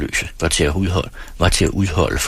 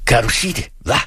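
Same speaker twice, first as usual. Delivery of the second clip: angry demand; 4 semitones higher than usual. A narrator's reading. Angry father demands a repeat.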